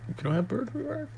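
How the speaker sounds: high, quick disbelieving question; genuinely taken aback, pitch shooting up; startled high-pitched question; surprised. Real, not acted. Surprised question about birds.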